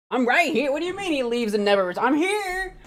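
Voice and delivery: high, fast indignant voice; high-pitched protest. Acted. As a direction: squeak it out, offended.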